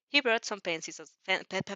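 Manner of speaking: ordinary delivery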